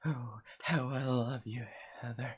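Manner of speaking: whisper-close creepy devotion; creepy guy voice. Acted. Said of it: "pretty good creepy guy voice".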